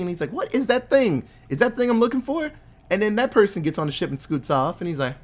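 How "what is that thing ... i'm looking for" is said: high-pitched, incredulous questioning; mock suspicious demand. Vader spotting the stolen plans, imitated high and insistent.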